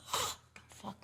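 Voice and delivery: hushed, voiceless disbelief; shocked whisper. Retold or imitated. Frightened client watching the exorcism, shocked whisper. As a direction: whisper it in stunned alarm. Frightened aside during retold ritual.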